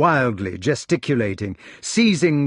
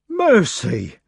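Meleagrance voice; 6 semitones higher, 4 dB louder than usual. A narrator's reading. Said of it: "said Sir Meleagrance".

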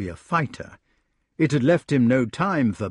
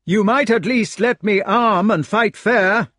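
indignant knight; 10 semitones higher, 6 dB louder than usual. Acted. Lancelot bargains.

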